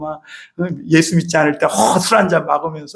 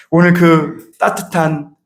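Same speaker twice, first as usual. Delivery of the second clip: normal talking voice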